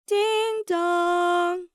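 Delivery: high, loud, long sung ding-dong; doorbell chime. A narrator's reading. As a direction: ring out like a doorbell. Narrator voices the doorbell chime.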